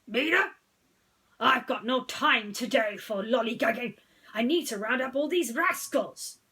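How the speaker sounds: loud, stern lawman brush-off; gruff sheriff. A narrator's reading. Sheriff brushing off Mater while chasing tractors, stern and loud. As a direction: wave him off, busy and stern.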